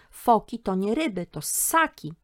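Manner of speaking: put-on loud, high earnest girl's correction; polite correction. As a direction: politely set the old man straight. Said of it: "próbowała grzecznie uzmysłowić".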